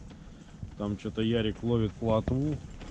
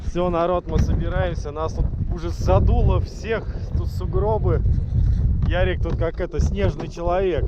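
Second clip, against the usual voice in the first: loud; 6 semitones higher, 5 dB louder than usual. Real, not acted. Shouting over strong wind.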